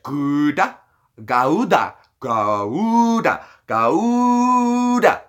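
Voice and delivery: "Gouda" with a hard G and drawn-out middle; hard Dutch G. Shown as a demonstration. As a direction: try Gouda in overdone Dutch, stretching the vowel. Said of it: "With a hard Dutch G".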